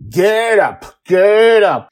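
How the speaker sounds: high, loud repeated order; shouted command. Acted. Joy runs at kneeling Justin, shouting orders. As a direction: yell to haul him onto his feet.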